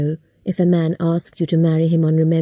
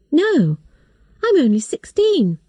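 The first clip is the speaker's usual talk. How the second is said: put-on a narrator's light girl voice, pitched up; nervous girl answering a stranger, higher; young girl, higher; 8 semitones higher than usual. A narrator's reading. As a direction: give Kate a higher, youthful voice.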